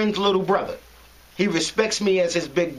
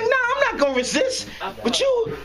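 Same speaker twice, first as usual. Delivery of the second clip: much higher, mocking taunt. Arrested man taunts the cop, high mocking tone. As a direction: sing it back at him, mocking.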